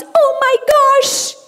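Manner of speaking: very high cartoon exclamation; Minnie. Dubber showing what her character says a lot, squeaky cartoon voice. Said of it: "A Mini fala demais".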